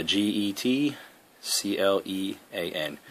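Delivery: slow, letter by letter; spelling it out. Shown as a demonstration. Gamer spelling his username for friends, slow and deliberate. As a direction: spell the gamertag slowly for viewers. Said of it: "it's Get Clean".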